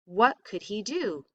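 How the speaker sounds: ordinary delivery, nothing marked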